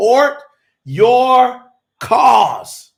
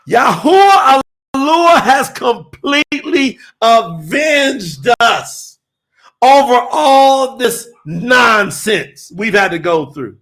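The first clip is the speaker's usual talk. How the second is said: full-force preaching; 3 semitones higher, 3 dB louder, slower than usual. Acted. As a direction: proclaim it like a revival preacher.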